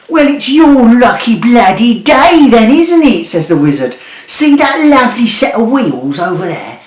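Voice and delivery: high, loud brash wizard full of bluster; a cocky market trader of a wizard selling junk. A narrator's reading. Wizard mocking Jeremy and selling him a sat-nav, brash and loud.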